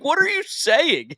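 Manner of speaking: high, drawn-out mock disbelief; retold scolding. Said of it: "James scolded him".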